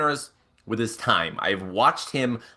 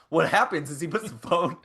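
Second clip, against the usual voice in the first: ordinary talk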